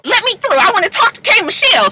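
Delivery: high, pushy demand to get through; mock angry mama. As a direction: play the mother forcing her way backstage. Big Lyrica storming the venue.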